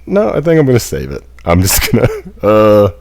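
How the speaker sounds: high, fast, loud nervous rush; panicked laugh. Real, not acted. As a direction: blurt it out in a flustered panic. Panicked backing out of plan.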